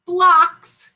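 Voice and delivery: high, loud "Blocks" cheer; excited reveal. Shown as a demonstration. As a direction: call out "Blocks" like a win.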